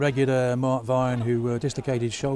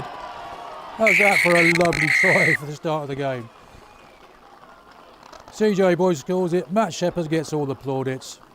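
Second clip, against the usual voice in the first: excited; 5 semitones higher, 4 dB louder than usual. Real, not acted. Try scored, commentator excited.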